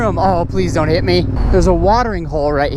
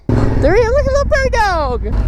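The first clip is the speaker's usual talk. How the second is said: very high-pitched delighted squeal; excited squeal. Real, not acted. Vlogger spotting a prairie dog, squealing with excitement. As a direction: squeal at spotting a cute animal.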